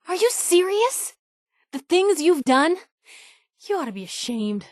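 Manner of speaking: high, disbelieving rebuke; indignant outrage. Acted. Companion scolding the player for cruel deeds, in high indignant outrage. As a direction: let the disbelief spike into scolding.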